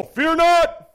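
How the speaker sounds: proclaiming Moses. Retold or imitated. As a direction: stand tall and proclaim to the crowd. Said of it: "Moses said to the people".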